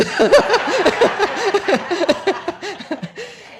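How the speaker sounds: big rising laugh; loud high gleeful laugh. Real, not acted. Preacher laughing at the officer's turnaround, loud high laugh. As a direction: laugh out with delight.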